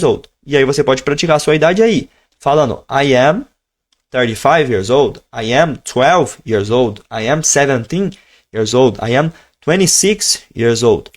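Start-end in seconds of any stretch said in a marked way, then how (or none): none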